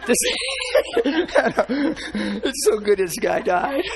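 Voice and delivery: high, loud, helpless laughter in the words; laughing while talking. Real, not acted. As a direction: squeeze the words out through real laughter.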